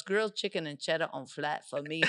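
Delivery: lightly imitated African customer accent. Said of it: "the African dude comes in my work".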